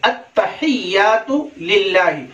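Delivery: "अत्तहियातु लिल्लाहि" slow, every h sounded; careful, slow "अत्तहियातु लिल्लाहि" with long vowels; correct recitation. Shown as a demonstration. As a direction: draw out "अत्तहियातु लिल्लाहि" as "at-ta-hiy-yaatu lil-laahi". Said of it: "ये सही है".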